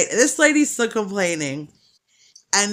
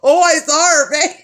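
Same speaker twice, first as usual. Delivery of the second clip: excited retold remark; 8 semitones higher, 8 dB louder than usual. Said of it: "was like".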